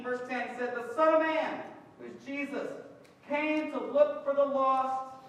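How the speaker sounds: loud, high, emphatic sermon delivery, slowing on key lines; full-force preaching. Acted. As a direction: drive the point home to the congregation.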